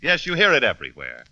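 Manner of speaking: ordinary delivery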